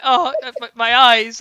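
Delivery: high, loud pained outcry; mock anguish. Retelling his friend's reaction, hammed-up anguish. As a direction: imitate someone appalled by an eyesore.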